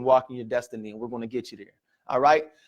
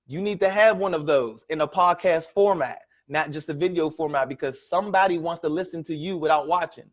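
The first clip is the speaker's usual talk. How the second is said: normal talking voice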